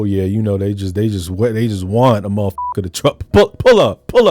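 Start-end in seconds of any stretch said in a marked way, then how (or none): 3.3-4.3 high, loud barked "pull up"; a hammed-up street holler; mock holler. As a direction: yell it like you're calling someone out. Mocking a hollered demand.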